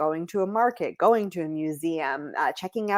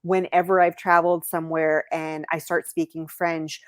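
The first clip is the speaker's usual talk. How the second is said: usual speaking voice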